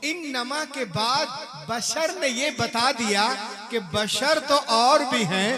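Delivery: loud, fervent sermon delivery; fiery pulpit preaching; preaching at full force. Acted. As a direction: rouse the congregation.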